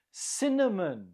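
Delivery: "Cinnamon" stressed on CIN, falling away after; stress on first syllable. Shown as a demonstration. Teacher models the word for learners, CIN stressed in "Cinnamon". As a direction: hit the first syllable of "Cinnamon", reduce the rest. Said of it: "the stress is on the first syllable".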